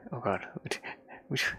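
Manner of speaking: ordinary talk, nothing marked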